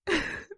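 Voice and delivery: short, high real giggle; stifled giggle. Real, not acted. Reader trying to resume the story, stifled giggle. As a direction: let a small laugh escape.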